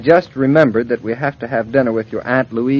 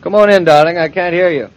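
ordinary talk, nothing marked

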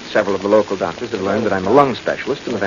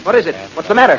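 high, louder startled questions; alarmed; 6 semitones higher, 4 dB louder, faster than usual. Acted. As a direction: demand urgently what is wrong. Husband startled by her scream.

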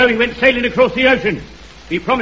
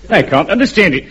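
ordinary talk, nothing marked

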